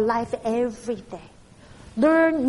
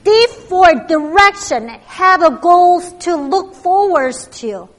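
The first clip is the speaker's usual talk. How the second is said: excited; 4 semitones higher, 7 dB louder than usual. Real, not acted. After crowd chant, energized.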